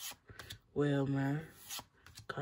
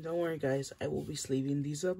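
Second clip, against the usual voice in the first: ordinary talk, nothing marked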